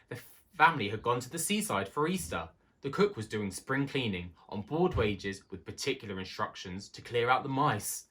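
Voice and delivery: usual speaking voice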